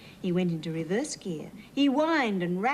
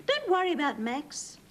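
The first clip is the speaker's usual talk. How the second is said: farmer voice; 7 semitones higher than usual. Acted. Farmer comforting horse.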